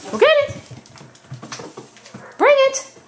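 high, bright fetch commands; high dog-talk voice. Real, not acted.

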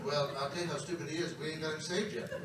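low, soft, quick aside; dry confiding reply. Retold or imitated. Voices Jesus joking in reply.

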